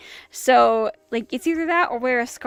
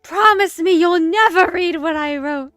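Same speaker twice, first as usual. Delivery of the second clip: higher, louder, urgent plea; insistent acted-out plea; imploring diary writer; 4 semitones higher, 10 dB louder than usual. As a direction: plead loudly, stressing each promise. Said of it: "He's like".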